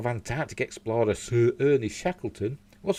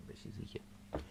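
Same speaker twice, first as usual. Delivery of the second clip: whispering; 21 dB quieter, slower than usual. Real, not acted. Whispered aside about the cat.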